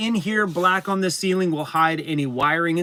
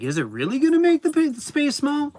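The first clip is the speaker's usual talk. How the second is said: much higher, quick sceptical question; mock disbelief; 10 semitones higher than usual. Owner mocking critics of his dark paint, higher. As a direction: scoff at the idea. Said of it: "But I'm like".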